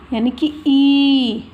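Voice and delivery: दीर्घ ई, खींचकर; long ee. Shown as a demonstration. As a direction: stretch the ई like a teacher showing the long vowel. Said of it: "बड़ी ई".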